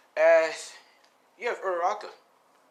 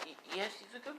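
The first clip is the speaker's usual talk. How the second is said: put-on higher, softer, flustered girl's stammer; shy stammer; 8 semitones higher, 14 dB quieter than usual. Kendo, blushing, answering Izuku with a shy stammer. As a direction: stumble over it, blushing.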